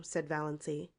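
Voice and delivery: ordinary delivery, nothing marked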